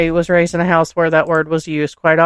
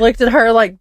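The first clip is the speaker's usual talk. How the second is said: usual speaking voice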